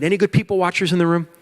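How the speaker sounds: ordinary talk, nothing marked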